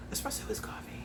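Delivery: a dry whispered aside. Real, not acted. Mocking the label's wording to herself, whispered. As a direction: whisper it to yourself, deadpan.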